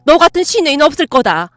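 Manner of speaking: loud enraged tirade; furious yelling. Acted. Mother throwing daughter-in-law out, loud enraged yelling. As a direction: throw her out in a rage. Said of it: "소리치셨어요".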